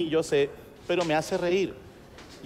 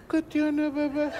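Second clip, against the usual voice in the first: put-on high, soft baby talk to a grown wife; voz de niña; 11 semitones higher, 3 dB quieter, slower than usual. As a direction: coo it like talking to a little girl. Marido habla como a niña. (voz aniñada y melosa)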